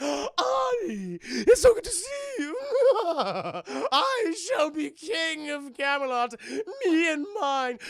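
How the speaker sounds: high, loud cackling boast; gloating villain laugh. Retold or imitated. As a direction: laugh through the triumph like a cartoon villain. Villain gloats to King Arthur.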